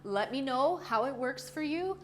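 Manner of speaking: normal talking voice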